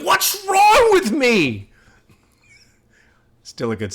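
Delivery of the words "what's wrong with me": loud, high, drawn-out mock scream; imitated scream. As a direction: scream it slowly like the anguished singer. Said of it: "He's screaming it".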